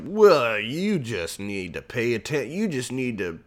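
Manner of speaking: hammed-up offended Republican; mock indignant Republican. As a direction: sneer it back as a self-righteous party man. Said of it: "those Republicans were like".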